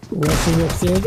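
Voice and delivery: usual speaking voice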